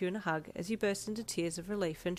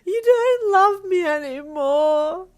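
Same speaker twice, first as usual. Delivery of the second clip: very high, loud, slow wail; long loud crying wail; 12 semitones higher, 14 dB louder, much slower than usual. A narrator's reading. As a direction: wail it through sobs. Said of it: "you wailed".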